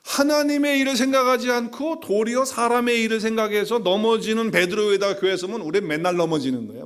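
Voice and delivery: high, loud preaching push; fiery preaching. Acted. Pastor warning the church from the pulpit, full-force preaching. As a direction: drive the warning home to the congregation.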